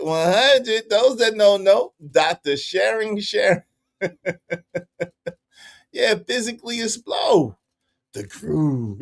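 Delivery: usual speaking voice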